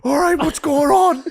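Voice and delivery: put-on high, loud confused drunk dad; flustered and drunk, asking what's happening. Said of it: "He was like".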